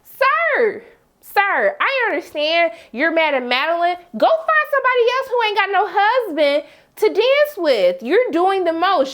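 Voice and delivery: sir called out high and rising, then telling him off; hammed-up exasperation at a man on TV; exasperated scolding. Talking back to the show, she scolds Nathan, high and exasperated. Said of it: "And I'm like".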